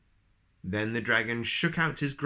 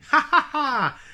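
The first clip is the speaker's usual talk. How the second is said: much higher, louder belly laugh; roaring laugh; 9 dB louder than usual. Acted. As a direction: roar with helpless laughter. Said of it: "roared the dragon".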